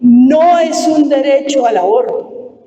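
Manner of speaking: each word spaced out and weighed; slow emphatic quote. Retold or imitated. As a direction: read the ruling slowly, word by word.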